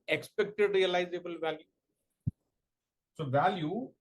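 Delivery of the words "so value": word-by-word dictation with long pauses; slow dictation. Real, not acted. Teacher dictating a definition to exam students, slow dictation. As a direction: dictate slowly, leaving time to write.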